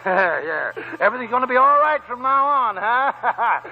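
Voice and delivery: put-on cackling old man, laughing through the words. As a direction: laugh it out with glee. Old prospector laughing with gold.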